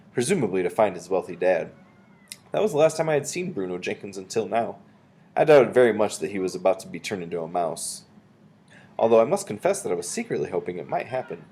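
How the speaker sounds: plain reading voice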